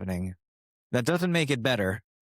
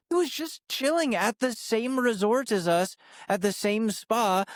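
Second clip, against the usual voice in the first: higher, rushed defensive voice; flustered explaining; 9 semitones higher than usual. Retold or imitated. Younger self explaining the hot tub meeting, high and flustered. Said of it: "I'm like".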